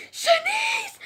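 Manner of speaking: very high, loud shrieked call; Gabby. Retold or imitated. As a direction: shriek a name down the hallway, imitating a bossy schoolmate. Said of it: "I hear Gabby's voice like".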